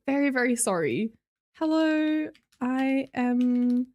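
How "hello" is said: ordinary talk, nothing marked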